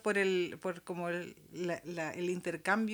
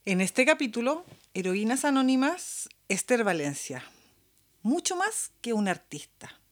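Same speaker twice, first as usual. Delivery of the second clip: louder, punchy radio promo voice; announcer read; 5 dB louder than usual. Acted. Host announcing the program's segments to listeners, in a promo voice. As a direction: sell the upcoming episode like a trailer.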